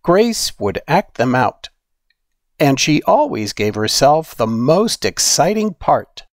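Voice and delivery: plain reading voice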